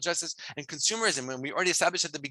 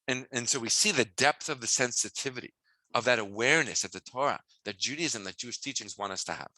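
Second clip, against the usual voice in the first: usual speaking voice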